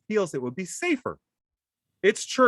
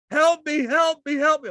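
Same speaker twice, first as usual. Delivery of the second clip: high, loud imitated cries; mock screaming; 12 semitones higher, 6 dB louder than usual. Imitating his wife running from robins, mock screaming. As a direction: wail it like she's under attack. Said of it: "She's screaming".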